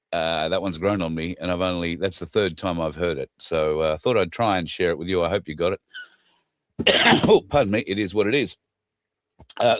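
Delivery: ordinary delivery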